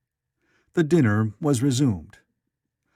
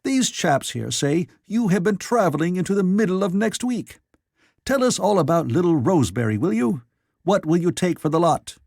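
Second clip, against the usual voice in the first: higher, louder, gleeful ribbing; a roaring editor mocking his friend; hilarious teasing; 6 semitones higher, 3 dB louder than usual. A narrator's reading. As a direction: tease him with loud glee. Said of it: "said the editor hilariously".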